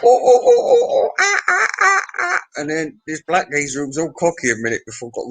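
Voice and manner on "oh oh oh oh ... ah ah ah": very high, loud imitated whooping cries; shouted hoots. Drunk heckling a man at the bar, shrieked hoots. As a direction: hoot out a string of oohs and aahs, far above normal pitch. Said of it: "he starts shouting out".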